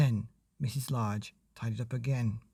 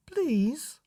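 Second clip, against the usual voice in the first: put-on high child's please; high begging please; pleading child; 13 semitones higher than usual. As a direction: beg sweetly.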